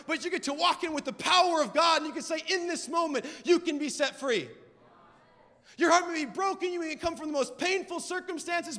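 driving, emphatic sermon delivery; punchy pulpit proclamation; impassioned preaching. Real, not acted. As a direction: preach it with rising conviction. Preaches at full force.

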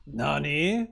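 a lightly put-on Japanese "Nani?" with a rising question; teasing "Nani?" with a questioning lift; mock Japanese. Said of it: "You like to speak Japanese?".

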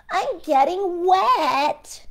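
high, slow drawn-out whine; worried gingerbread man. Acted. The gingerbread man complaining again to the fox, high and slow.

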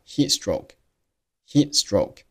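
very slow "heat stroke", t held silent before s; "heat" closing on a silent t into "stroke"; unreleased t in heat stroke. Shown as a demonstration. Teacher slowly showing the t dropped in "heat stroke". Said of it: "读成了heat stroke".

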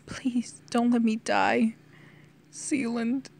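hushed, crying plea; tearful plea. A narrator's reading. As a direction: beg weakly through understated tears. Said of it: "now crying as well".

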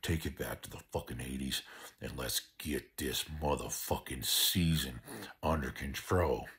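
normal talking voice

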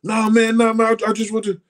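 high, loud imitation of an insistent fan; eager stranger interrupting. Retelling a man approaching him in the store, insistent.

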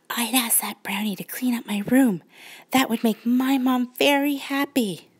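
sleepy bedtime whisper; a child whispering her wish; whispering little girl. Acted. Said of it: "said Cindy".

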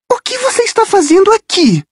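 voz aguda, surpresa de menina; voz de garota assustada. Acted. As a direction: agarra o ombro e pergunta, espantada. Rachel surprende Percy. (Rachel pergunta a Percy, surpresa e aguda)

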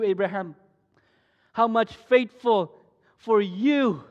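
loud, booming divine voice; voice of God. Acted. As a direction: speak as God, loud and tender. God voiced speaking to preacher.

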